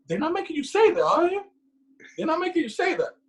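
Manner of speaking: imitating suspicious mom. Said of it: "mom on the phone".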